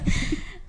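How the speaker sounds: airless high giggle; breathy laugh. Real, not acted. Laughing with the friends at the story. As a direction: laugh almost without voice. Laughter after retold insult.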